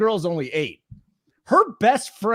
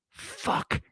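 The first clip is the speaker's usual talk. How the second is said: unvoiced hushed whisper of the word; whispered swear; 11 dB quieter than usual. A dad retelling his daughter whispering the swear to him. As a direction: breathe it out with no voice, like a scared kid.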